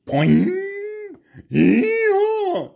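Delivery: loud, very high hee-haw; the donkey kicks free with a loud, high bray. A narrator's reading. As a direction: bray like a donkey breaking loose.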